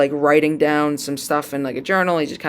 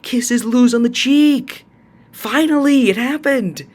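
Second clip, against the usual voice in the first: excited squeal; 8 semitones higher, slower than usual. Real, not acted. Finally seeing the kiss happen.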